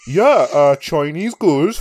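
mock coerced praise, pitch swooping; hostage under duress. Podcaster pretends to praise at knifepoint, mock coerced. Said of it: "tied to a chair with a fucking blindfold".

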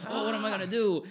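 high-pitched helpless wail; mock distress. Voices helpless person in ad.

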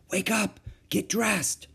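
put-on a kid waking her brother up; eager young girl. A narrator's reading. Annie wakes brother at midnight.

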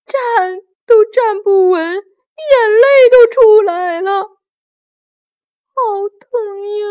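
high, whining cry of pain; mock tearful wail. A narrator's reading. Storyteller as the hurt, dizzy monkey hero, tearful wail. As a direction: wail through it as if in tears.

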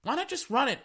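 high, baffled question; high exasperated why not; exasperated fan. Retold or imitated. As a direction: plead with the QB, high and bewildered. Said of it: "I was like".

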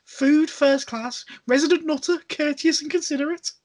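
Scouse voice, upbeat review; Liverpool accent. Acted. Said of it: "Your Liverpool accent's really good".

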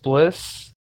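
usual speaking voice

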